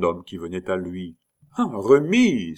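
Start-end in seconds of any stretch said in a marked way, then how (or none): 1.6-2.6 loud, high cry of recognition; answering exclamation. A narrator's reading. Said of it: "s'écria-t-il à son tour".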